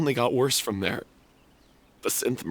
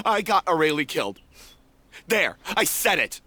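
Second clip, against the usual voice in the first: rising, louder bitter outburst; bitter confession; 8 semitones higher, 3 dB louder than usual. Acted. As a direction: confess it and throw it back at them. Character confesses in anguish.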